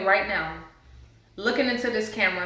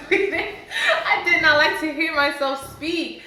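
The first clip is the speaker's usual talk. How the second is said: much higher and louder, laughing through it; 8 semitones higher, 4 dB louder than usual. Real, not acted. Telling viewers about hating her voice, laughing.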